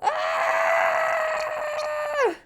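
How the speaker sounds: a long, very high shriek; high anguished shriek; fan shriek. Real, not acted. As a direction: cry out in distress. Shriek of distress over the panels.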